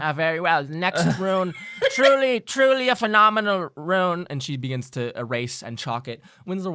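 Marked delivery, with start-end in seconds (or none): 1.9-4.3 high, loud, delighted exclamation; gushing professor. Acted. Professor gushing over the rune to her class, high and loud. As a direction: rave about the rune like a thrilled teacher.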